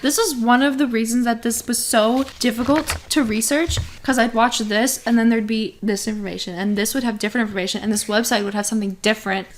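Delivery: worked-up, rapid explanation; talking too fast in real exasperation; heated. Real, not acted. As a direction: rattle it off fast, getting heated. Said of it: "You're getting so heated".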